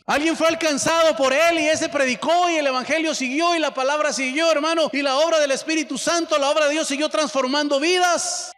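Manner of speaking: loud, high fervent preaching; full-force sermon voice. Acted. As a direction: lift it up and proclaim it. Preaching climax.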